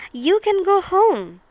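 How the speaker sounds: a hint of a small girl letting go; Thumbelina's voice. Thumbelina sending the bird home, in a light girlish voice.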